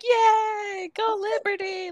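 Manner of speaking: very high-pitched crowd cheer; mock cheering. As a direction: cheer like an adoring crowd, playfully. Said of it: "everyone's happy and cheering".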